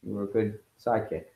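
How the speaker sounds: ordinary delivery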